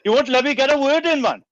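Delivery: high, loud, hammed-up grumbling; mock exasperated. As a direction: jokingly scold him for hogging the mic. Playful mock complaint at host.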